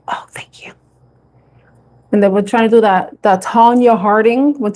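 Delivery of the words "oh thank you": whispered thanks. Retold or imitated. Retold reply to mother.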